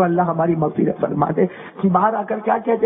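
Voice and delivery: usual speaking voice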